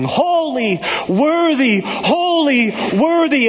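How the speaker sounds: rising, fervent cries of holy and worthy; crying out praise. Acted. Preacher voicing heaven's crowd crying praise, fervent. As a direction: call each word out like the heavenly crowd.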